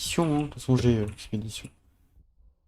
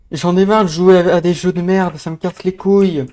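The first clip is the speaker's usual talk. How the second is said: loud, high furious rant; raging gamer; 4 semitones higher, 12 dB louder than usual. Real, not acted. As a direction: yell it out at the game. (joueur enragé qui hurle)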